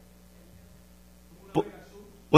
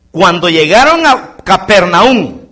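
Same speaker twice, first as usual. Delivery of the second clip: slow, louder preaching cadence; fervent preaching; 4 semitones higher, 3 dB louder, slower than usual. Acted. Pastor reads the Bible story to the church in preaching cadence. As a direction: preach it from the pulpit.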